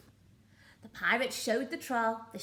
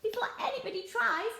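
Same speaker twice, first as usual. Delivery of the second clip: high, hurried pirate urging; urgent pirate; 13 semitones higher than usual. Acted. Pirate captain hurrying his crew to the ship, high and urgent. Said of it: "said Handkerchief".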